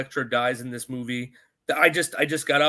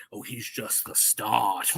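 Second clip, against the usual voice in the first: slow, soft mock villain line; hammed-up sinister quote, low and slow; Harry Osborn; 5 semitones lower, 5 dB quieter, slower than usual. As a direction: draw it out like an ominous movie villain. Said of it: "Harry Osborn even says".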